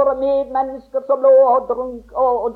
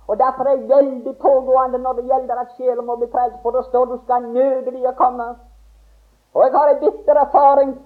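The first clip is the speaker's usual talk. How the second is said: ordinary talk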